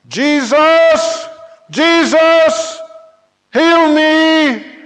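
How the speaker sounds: very high, loud, drawn-out cries; hollering. Acted. Blind man calling out to Jesus for healing, hollering. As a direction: holler desperately over the crowd.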